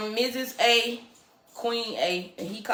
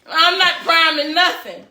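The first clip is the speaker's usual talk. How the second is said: louder and higher, real playful yell; 8 semitones higher, 8 dB louder than usual. Real, not acted. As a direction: shout it back at him, half joking. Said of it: "Why you yelling?".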